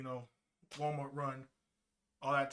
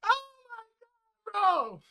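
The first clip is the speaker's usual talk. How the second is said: winded, laughing, voice pitched up; out of breath; 6 semitones higher, 4 dB louder than usual. Real, not acted. Streamer winded after reenacting his yell, out of breath. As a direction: gasp and laugh, winded from yelling. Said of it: "running out of breath just doing that".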